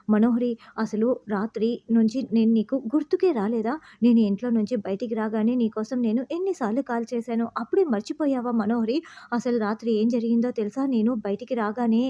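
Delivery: plain reading voice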